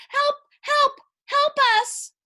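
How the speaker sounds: high loud repeated yells; desperate cries for help. A narrator's reading. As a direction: shout for help, clinging on. Calling for help.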